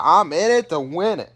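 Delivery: eager. Retold or imitated. Grandma Faye cheering her grandson in Vegas, excited. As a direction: hype it up like Faye ready to party. Faye's eager quote retold.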